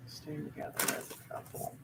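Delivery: whispered to herself while shuffling; whispering. Real, not acted. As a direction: breathe the words without voice. Repeating question while drawing cards.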